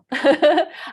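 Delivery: short high burst of laughter. Real, not acted. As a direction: laugh out loud, amused. Joking about hairy legs.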